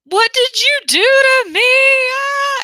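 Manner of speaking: high, loud hammed-up outcry; teacher hamming up being hit, loud and high; mock scream. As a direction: act out being hit, then yell. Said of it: "And I scream".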